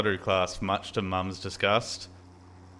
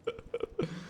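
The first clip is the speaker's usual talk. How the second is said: high laugh; 10 dB quieter than usual. Real, not acted. Laughing at own story.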